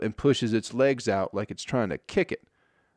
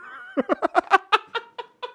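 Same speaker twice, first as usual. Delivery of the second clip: very high falsetto cackle; a mock squawking hen laugh. Hen cackling at the fight, very high falsetto. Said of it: "hen laughing".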